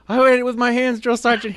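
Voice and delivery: high, loud sounded-off reply; recruit sound-off. Retold or imitated. Recruit admitting he ate with his hands, loud sound-off. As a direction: answer at full volume.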